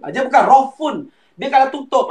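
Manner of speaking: ordinary delivery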